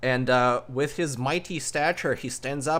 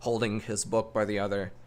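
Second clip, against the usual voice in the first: ordinary talk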